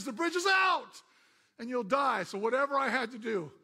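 loud, high shouted warning; a man pleading loudly to stop a car; desperate shout. Acted. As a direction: yell it to save a life.

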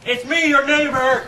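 high, loud holler; calling out. Pretend. Paul announcing himself to the neighbor, high holler. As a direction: holler to the whole house.

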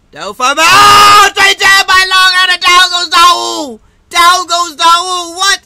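huge high-pitched shout, stretched out; screaming play-by-play; knockdown call. Real, not acted. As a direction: erupt as the fighter hits the canvas. Calling a sudden knockdown live.

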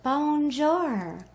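put-on bright French-accented greeting. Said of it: "excuse my little French accent".

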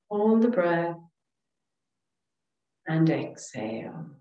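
calm, soothing relaxation voice with long pauses; guided meditation. Real, not acted. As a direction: guide them gently through each breath, leaving space. Leads breathing and meditation.

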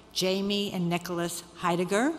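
usual speaking voice

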